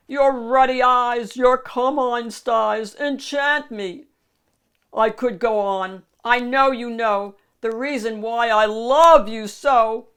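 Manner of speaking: loud, lovestruck ogre; wooing. Acted. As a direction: declare it loudly with relish. Shrek wooing princess.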